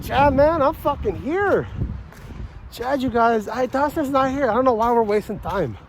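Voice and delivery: mock a smug wrestler dismissing his opponent; a hint of an arrogant wrestler on the mic; cocky wrestler promo.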